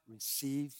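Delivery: a gentle blessing, softly breathed; soft breathy quote. Retold or imitated. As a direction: hush it out as Jesus. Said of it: "breathed on the disciples and said".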